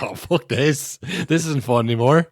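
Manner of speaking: mock giving up in disgust; fed-up fan. Fan quitting after another second place, exasperated. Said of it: "I was like".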